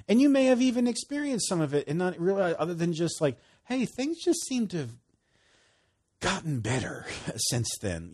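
lifted, eager telling of how things got better; bright enthusiastic testimony, pitch up; excited. Real, not acted. Preacher telling listeners life improved, excited.

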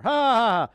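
loud, high, deliberate "ha, ha, ha"; big put-on taunting laugh; mocking laugh. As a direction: laugh in the devil's face. Laugh at him some more.

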